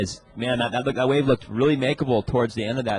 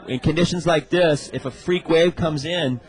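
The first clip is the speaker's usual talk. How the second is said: surf contest announcer; 3 semitones higher than usual. Real, not acted. Live surf contest commentary.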